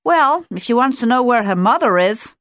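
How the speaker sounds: mother otter cheerfully reassuring the little one. A narrator's reading. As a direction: sing it out like a cheery mum. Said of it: "said Carrie cheerfully".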